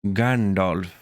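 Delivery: lightly voiced old wizard; a hint of a wise old wizard; Gandalf voice. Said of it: "He's obviously gonna go".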